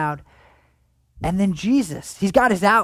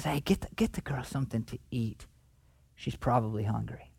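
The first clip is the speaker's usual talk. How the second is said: hushed whisper; 5 semitones lower, 10 dB quieter than usual. Retold or imitated. Jesus tenderly instructs servants.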